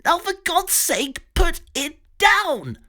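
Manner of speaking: high frantic plea; desperate Pig. Acted. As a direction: beg it high, slowing on put it down. Pig begging at gunpoint.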